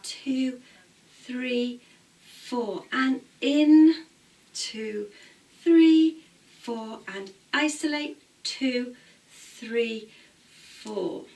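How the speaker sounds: loud rhythmic counting of the beats; dance class count. Shown as a demonstration. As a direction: call the counts out to the class in time. Dance teacher counting out moves.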